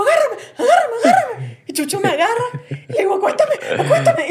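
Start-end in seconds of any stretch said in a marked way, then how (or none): 0.0-1.6 high, urgent begging; retold pleading. Host retells begging Chucho to hold her, high pleading. 3.1-4.3 high, urgent begging; retold pleading. Host retells begging to be laid down, high pleading.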